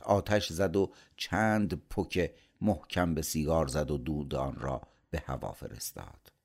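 plain reading voice

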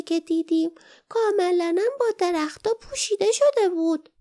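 a tiny creature wondering aloud; small creature voice. A narrator's reading. Perrin recalls her mother's stories, high creature voice. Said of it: "پرین آهسته گفت".

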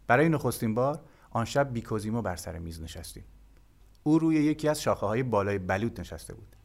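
ordinary delivery

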